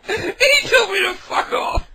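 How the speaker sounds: high, loud squeal of laughter, drawn out; shrieking laughter. Real, not acted. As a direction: crack up so hard the voice squeaks. Laughing after being hung up.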